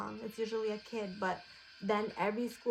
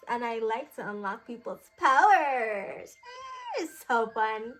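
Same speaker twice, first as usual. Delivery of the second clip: excited genie boast; 8 dB louder, slower than usual. Pretend.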